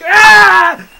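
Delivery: high, very loud performed yell. Retold or imitated. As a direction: scream out like a falling stuntman. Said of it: "yelling like".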